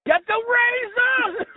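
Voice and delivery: high, drawn-out mock yell; hammed-up slow yell of the catchphrase; joke yell. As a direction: bellow it slowly as a running joke. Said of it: "you just yell".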